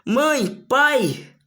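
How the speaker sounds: loud, high-pitched cry for mom and dad; acted shout. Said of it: "E ela grita pela cidade".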